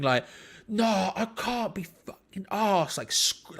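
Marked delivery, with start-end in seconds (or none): 0.7-3.0 angry retold shout. Fuming fan answering the taunts in the pub, angry. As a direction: re-enact snapping back in anger. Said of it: "like, shouting it".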